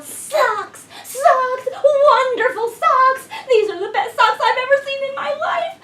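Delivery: high, loud, thrilled shouting about socks; excited yell. A narrator's reading. Tina yelling to her family at home, thrilled and loud. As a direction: cheer like a child with a new treasure. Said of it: "Tina ran home and yelled".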